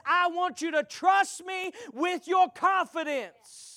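high, shouted preaching climax; preaching at full force. Acted. Preacher voicing Abraham's pride to the church, full-force preaching. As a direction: whoop it up to the congregation.

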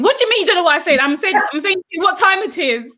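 mock exasperation, insisting on being understood; angry child. Mother imitating her son angry at the Google device, indignant. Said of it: "He's getting so angry at this thing".

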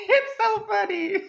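high, helpless real laughter; laughing. Real, not acted. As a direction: squeal the words through laughter. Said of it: "Okay, I'm laughing".

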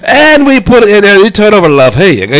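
put-on light British accent, casual; a hint of a British accent, chatty; British accent imitation. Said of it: "he's talking in his British accent".

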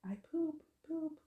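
put-on high little-kid cry at normal volume; high-pitched pretend tantrum wail; mock toddler scream. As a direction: imitate a screaming child, held back. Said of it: "he just keeps screaming".

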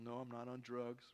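ordinary talk

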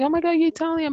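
high-pitched retold excitement; excited greeting. Retelling the same greeting, excited. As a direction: act out gushing delight. Repeats delighted greeting to Italians.

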